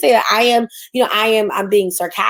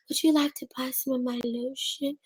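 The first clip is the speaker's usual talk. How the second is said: timid vendor; 3 semitones higher, 11 dB quieter than usual. Retold or imitated.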